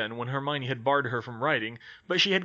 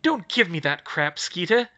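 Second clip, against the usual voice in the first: louder, higher angry retort; snapped; 5 semitones higher, 6 dB louder than usual. Acted. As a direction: bite back at Skeeter. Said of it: "Hermione snapped".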